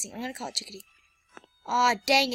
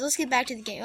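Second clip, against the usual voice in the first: ordinary talk, nothing marked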